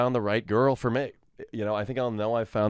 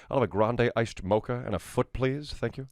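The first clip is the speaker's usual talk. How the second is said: usual speaking voice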